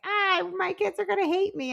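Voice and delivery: high-pitched mock fretting; mock worry. Mom losing sleep over her kids, mock-worried. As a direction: fret in an exaggerated high voice. Mocks a mother's needless worry.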